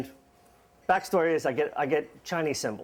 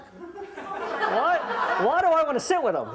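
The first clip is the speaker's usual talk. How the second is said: high, fast and laughing; bewildered when asked to hold a grown man's hand; shocked disbelief; 11 semitones higher, slower than usual. Retold or imitated. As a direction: squeal it out in amazed disbelief. Asked to sit with friend.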